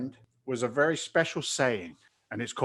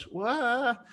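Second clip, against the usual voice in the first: high, drawn-out mock-startled what; exaggerated bewilderment; stunned; 11 semitones higher than usual. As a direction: imitate someone caught off guard, pitched way up. Retold startled reply of the guru.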